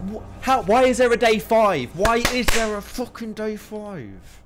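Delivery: loud, high-pitched frustrated complaint; exasperated outburst. Real, not acted. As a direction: protest in real exasperation. Exasperated at the game dragging on.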